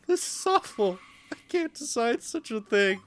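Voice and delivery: very high, small voice; high, helpless character voice; distressed young character. Acted. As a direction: plead in a high, dismayed voice. Voicing distressed character, impossible choice.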